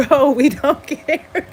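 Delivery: slow words through real laughter; crying laughing. Real, not acted. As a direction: drag it out while cracking up. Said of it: "I'm literally crying".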